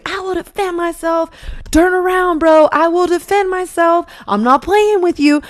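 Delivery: high-pitched mock scream of warning; a hammed-up high warning yell. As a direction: shout it up high to scare him off. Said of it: "I mean, I'm screaming".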